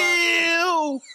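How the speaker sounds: high, drawn-out villain shout; Skeletor. Acted. Playing a cartoon villain for a friend, commanding shout. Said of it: "That's how he was coming".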